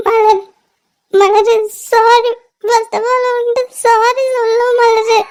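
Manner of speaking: crying, halting and subdued; tearful apology. Acted. Owing her close friend an apology, crying. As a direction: apologise through sobs. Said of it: "இந்த மாதிரி அழுது நான் பார்த்ததே இல்லையே".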